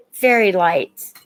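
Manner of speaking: ordinary talk, nothing marked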